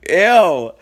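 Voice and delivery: loud high squeal of disgust. Real, not acted. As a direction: recoil out loud at the thought. Disgusted by own memory.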